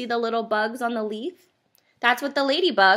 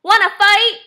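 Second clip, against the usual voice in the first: high, loud challenge; scrappy storybook bug; grouchy ladybug; 11 semitones higher, 8 dB louder than usual. A narrator's reading. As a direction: pick a fight, pitched up and loud. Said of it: "said the grouchy ladybug".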